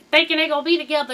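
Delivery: normal talking voice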